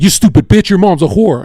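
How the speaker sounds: the friend's debating style, loud and high; imitating his insults. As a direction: play the crude debater mid-attack. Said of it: "the way he debates".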